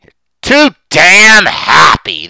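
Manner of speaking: very loud, high, drawn-out accusation; acted husband's outburst. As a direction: bellow each word slowly, as the bitter husband. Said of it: "that is the dark side of the human soul".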